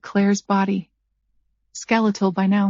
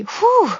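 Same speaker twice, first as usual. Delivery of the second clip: whew after laughing; 13 semitones higher, 3 dB louder than usual. A narrator's reading. Catching breath after laughing.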